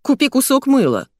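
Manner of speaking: acted snippy rebuke; rude jibe. A narrator's reading. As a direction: needle a stranger with contempt. Rude stranger insults the narrator.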